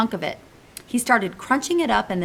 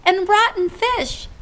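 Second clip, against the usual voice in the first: gasped; 11 semitones higher, 4 dB louder, slower than usual. Acted. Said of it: "she gasped".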